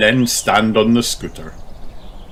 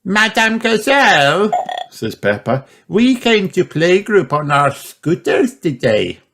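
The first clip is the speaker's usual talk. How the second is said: put-on high proud girl voice; Peppa; 10 semitones higher than usual. Peppa tells her teacher, high and proud.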